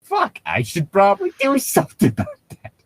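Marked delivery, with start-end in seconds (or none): none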